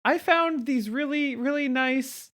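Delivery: put-on high, rushed nerdy girl voice; nervous Velma voice. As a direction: play Velma, flustered. Plays Velma in Scooby game.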